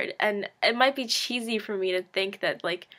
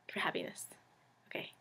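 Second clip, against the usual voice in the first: whisper; 12 dB quieter, faster than usual. Real, not acted. Whispered aside while collecting herself.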